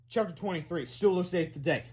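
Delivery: usual speaking voice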